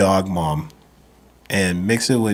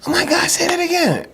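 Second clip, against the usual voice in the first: very high mock-girly squeal; hammed-up falsetto fangirl voice; gushing girls. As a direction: imitate girls fawning over an accent. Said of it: "and girls was like".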